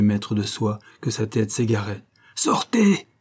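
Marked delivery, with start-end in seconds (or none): 2.3-3.1 high, loud hoarse cry; hoarse shout. A narrator's reading. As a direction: throw him out with a raw shout. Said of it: "cria-t-il d'une voix rauque".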